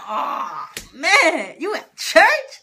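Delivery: loud, high-pitched groan of dismay; dismayed disbelief. Retold or imitated. Niece learning auntie is at church, dismayed. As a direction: wail it, shooting up high on the question.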